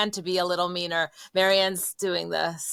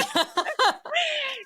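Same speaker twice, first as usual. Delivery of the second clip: big high-pitched laugh. Real, not acted. Big laugh at own joke.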